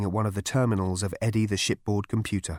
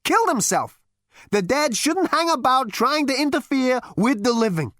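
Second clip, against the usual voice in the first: wild unhinged ranting; 4 dB louder than usual. A narrator's reading. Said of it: "he raved".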